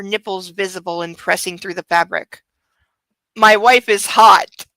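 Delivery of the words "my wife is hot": louder, slow, overdone swagger; milk the line like a bad romance hero; hammed-up lust.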